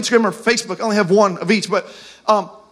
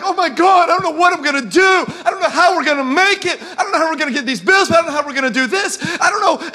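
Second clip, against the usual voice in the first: higher, hurried mock panic; acted-out anxious rambling; freaking out; 5 semitones higher, faster than usual. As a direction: rattle off worries in a rising fluster. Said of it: "when you and I are freaking out".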